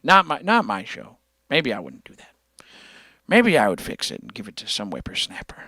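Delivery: put-on hushed, breathy old-timer voice; imitating Outlaw. Streamer mocking his friend Outlaw as a whispering old-timer. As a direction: whisper it like a grumpy old man. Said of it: "Outlaw's like".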